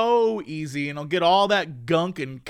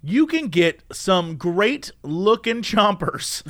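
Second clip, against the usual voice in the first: slow; much slower than usual. Acted. Playful ad lead-in.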